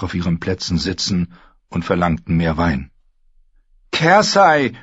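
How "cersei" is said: loud, high plea; pleading. A narrator's reading. Knight begs the queen to listen, pleading. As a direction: call her name imploringly. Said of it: "flehte Sir Lancel".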